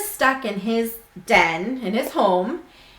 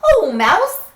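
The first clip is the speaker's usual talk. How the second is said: high, louder friendly hare voice; cheerful hare; 10 semitones higher, 7 dB louder than usual. Acted. Hare greeting the mouse, high cheery voice. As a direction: greet an old friend brightly. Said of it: "says Hare".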